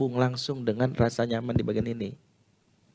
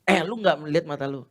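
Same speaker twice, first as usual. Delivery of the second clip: mock snapping; 7 semitones higher than usual. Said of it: "dia bentak-bentak".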